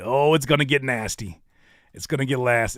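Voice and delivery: ordinary talk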